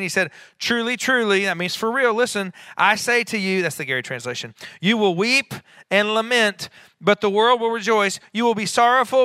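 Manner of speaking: high, loud preacher's cadence; fervent preaching. Real, not acted. Preacher promising the congregation that sorrow turns to joy, fervent. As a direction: drive the promise home with rising force.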